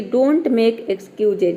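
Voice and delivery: "don't make excuses" said very slowly, each word apart; slow example sentence. Shown as a demonstration. Teacher slowly modelling "don't make excuses" for Hindi-speaking students. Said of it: "जैसे कि".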